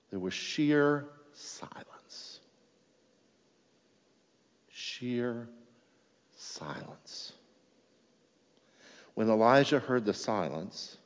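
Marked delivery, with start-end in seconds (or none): 0.1-2.4 hushed whisper, slowed right down; a slow reverent whisper. Shown as a demonstration. Reading of the still small voice. 4.7-7.3 softer, then fading to a whisper; fading from hush to breath. Shown as a demonstration. Repeating sheer silence, fading away.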